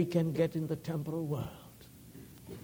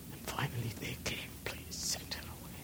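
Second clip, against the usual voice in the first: hushed quick telling; whisper; 2 semitones lower, 10 dB quieter than usual. Retold or imitated. Preacher retelling the disciples, whisper. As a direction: breathe the disciples' plea.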